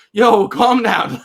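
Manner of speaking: slow, loud, high, chill-out brush-off; calm down. Retold or imitated. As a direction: stretch it out like telling a hyped guy to relax. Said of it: "I was like".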